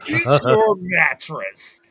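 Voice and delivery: slow, dopey cartoon voice. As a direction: drawl it like a goofy cartoon kid. Quoting Ed from cartoon.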